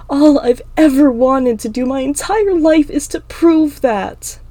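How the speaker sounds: high, loud, upset outburst; impassioned protest. Acted. Emma insists she has spent her life proving it, impassioned protest. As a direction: plead your case at a yell. Emma pleads while choking back tears.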